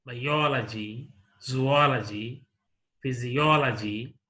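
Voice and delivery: "biology", "zoology", "physiology" stressed on the OL syllable; bi-OL-ogy, zo-OL-ogy, physi-OL-ogy, the third-last syllable stressed; antepenultimate stress. Shown as a demonstration. Teacher modelling word stress, "biology", "zoology", "physiology" stressed on OL. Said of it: "on the antepenultimate syllable".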